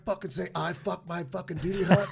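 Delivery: high, laughing mock yell; mock drill instructor. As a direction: play the angry sergeant while cracking up. Drill instructor yelling, retold.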